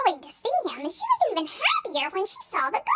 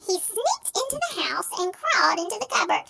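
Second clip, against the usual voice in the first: ordinary delivery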